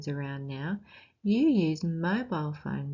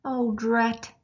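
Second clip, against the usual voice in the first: higher, louder exasperated cry; louder, higher annoyed exclamation; annoyed yell; 5 semitones higher, 5 dB louder than usual. Acted. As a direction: yell it like a fed-up dad. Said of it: "yelled Dad".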